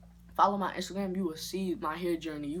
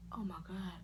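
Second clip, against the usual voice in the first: breathless whispered oh my God; whispered dread; 9 dB quieter than usual. Retold or imitated. Watching the barber shave too much, a horrified whisper. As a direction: whisper it in quiet horror. Seeing hair cut off.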